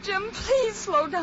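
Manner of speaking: high, loud, drawn-out pleading; alarmed plea. Acted. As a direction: beg the driver in rising fear. Frightened passenger pleads with driver.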